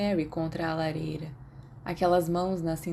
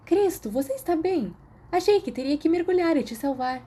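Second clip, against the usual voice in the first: higher, alarmed and concerned; lightly voiced startled girl; 10 semitones higher, 3 dB louder than usual. A narrator's reading. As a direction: rush to check he's okay. Girl startled by his sputtering.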